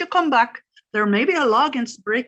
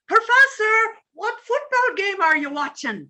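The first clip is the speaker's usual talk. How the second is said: calling up; 6 semitones higher than usual. Acted. Calling up the stairs again.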